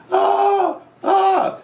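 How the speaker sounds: high mock scream of ecstasy; hammed-up ecstatic cry; mock ecstatic shout. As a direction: shriek it like on a rollercoaster ride. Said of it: "she's shouting".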